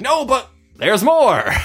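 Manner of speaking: slow, loud, high sales pitch; hammed-up salesman voice, slow and loud; pushy salesperson.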